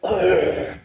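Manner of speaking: a deliberate throat clear. Shown as a demonstration. Coach showing a nervous filler, throat clearing. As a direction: clear your throat as if stalling for a word. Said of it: "too much of throat clearing".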